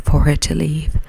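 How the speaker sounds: usual speaking voice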